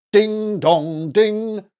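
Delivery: slow ding dong, rising to a loud high ding; chimed ding dong ding; clock chime. A narrator's reading. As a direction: ring it out like a clock bell. Clock striking.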